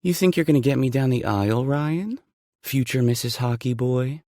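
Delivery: flirty heroine, light and teasing; woman's voice. Acted. Female lead voiced by narrator.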